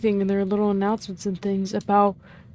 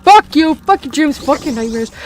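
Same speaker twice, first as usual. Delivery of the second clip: loud, higher rant that keeps spitting curses; angry rant; 3 semitones higher, 6 dB louder than usual. Real, not acted. Player ranting at a rival character, loud and higher. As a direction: tear into them point by point.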